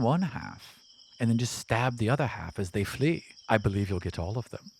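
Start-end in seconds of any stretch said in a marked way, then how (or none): none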